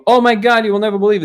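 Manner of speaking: high, loud "Oh my God" bursting with news; excited good news. Retold or imitated. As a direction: villagers rushing in to tell the monk, excited and high. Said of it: "And then they came".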